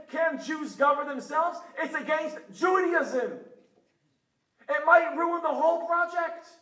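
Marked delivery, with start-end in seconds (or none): 0.1-3.4 loud, high, slow imitated protest; mock Haredi outcry. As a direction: hammer it out like the outraged Haredim. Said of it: "What will they say?".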